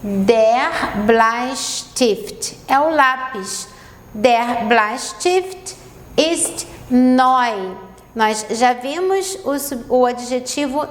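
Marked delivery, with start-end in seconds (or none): 0.0-2.6 der Bleistift, drawn out slowly; slow model phrase. Shown as a demonstration. Teacher introducing a new German noun, slowly. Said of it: "primeira frase". 4.1-7.8 der Bleistift, drawn out slowly; slow model phrase. Shown as a demonstration. Teacher introducing a new German noun, slowly. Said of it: "primeira frase".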